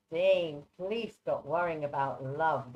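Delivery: ordinary talk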